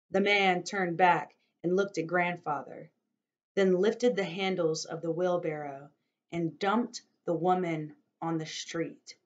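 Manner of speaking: expressive novel reading, voicing each figure; story narration. A narrator's reading. Narrator reading a chapter to listeners, storytelling voice. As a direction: tell the story to listeners, dramatically.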